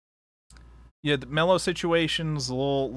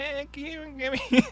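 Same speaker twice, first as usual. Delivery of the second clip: much higher whiny creature voice; nasally Shinigami; 11 semitones higher than usual. Shown as a demonstration. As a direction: whine it like a needy little death god. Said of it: "a nasally voiced Shinigami".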